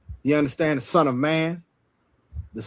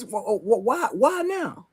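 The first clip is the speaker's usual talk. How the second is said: mock stammering disbelief; 6 semitones higher than usual. Mimics people second-guessing now.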